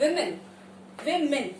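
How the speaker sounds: women said as WIM-in; WIM-in, plural of woman; plural women pronunciation. Shown as a demonstration. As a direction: say women with a short i, wimmin. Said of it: "not women".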